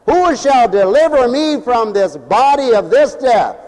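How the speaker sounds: slow, drawn-out mournful cry; lamenting quote. Acted. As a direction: plead it slowly like a man in despair. Quoting Paul in a lament.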